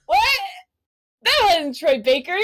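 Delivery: high, incredulous reenacted reaction; retold disbelief. Telling fans he was not the actor, incredulous. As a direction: squeak out a shocked question.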